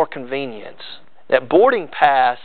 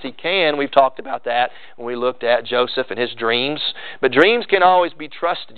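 normal talking voice

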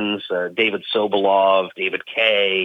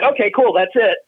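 casual producer voice; 10 semitones higher than usual. Retold or imitated. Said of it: "they were like".

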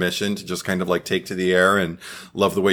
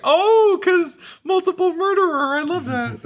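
high-pitched gleeful squeal; delighted chuckle. Retold or imitated. Fan recalling spotting the line, high re-enacted delight. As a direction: gush it way up high, tickled. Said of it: "I remember chuckling to myself, going like".

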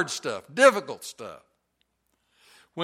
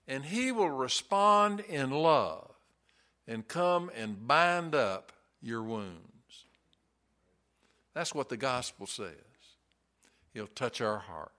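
tender reassurance; 4 semitones lower, 5 dB quieter than usual. Real, not acted. Softens into comfort.

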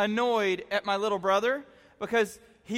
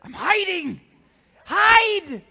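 high, loud snap of Hide; urgent mock shout; 10 semitones higher, 9 dB louder, much slower than usual. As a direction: shoo him away in a panic. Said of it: "I'm like".

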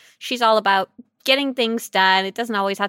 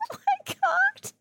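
high laughing squeal; real giggling fit; 6 dB quieter, slower than usual. Real, not acted. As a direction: laugh helplessly through it. Breaking up laughing.

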